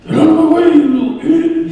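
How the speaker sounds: high, loud mock trucker yell; angry trucker. Imagined trucker behind him, a high loud mock yell. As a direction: bark it like an impatient truck driver. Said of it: "like, an angry guy".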